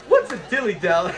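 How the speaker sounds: light mock Esther, still laughing; quoting Esther. Host repeating Esther's line amused, lightly voiced. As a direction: echo her line with a grin. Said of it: "quoting the clip while laughing".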